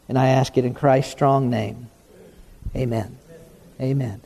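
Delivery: low, hushed pastor's prayer. Acted. Pastor praying over the congregation before preaching. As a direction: pray it low and humbly.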